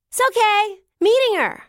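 loud high yell over distance; shouting back. Acted. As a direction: yell back without looking.

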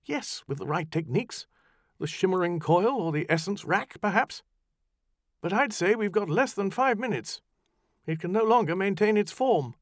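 high, hurried insistence; brisk urgency. Acted. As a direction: push the plan fast. Farrar pressing urgently.

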